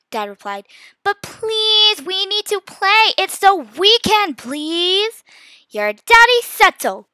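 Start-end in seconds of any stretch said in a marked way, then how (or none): 1.0-5.2 high, loud, drawn-out begging; whine-plead slowly with your parents; pleading child. A narrator's reading. 5.7-7.1 loud, slow scolding mom; angry shout. A narrator's reading. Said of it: "Mom shouted angrily".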